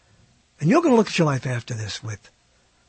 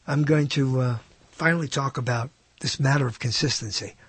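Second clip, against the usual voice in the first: ordinary delivery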